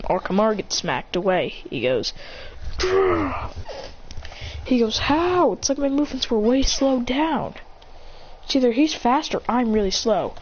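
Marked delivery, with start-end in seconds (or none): 2.8-3.5 low rolled growl. Acted. Knocked-back fighter growling. Imitated growl after being hit. 5.0-7.5 high-pitched baffled protest; disbelief. Acted. Beaten fighter baffled by slowed movements, disbelieving.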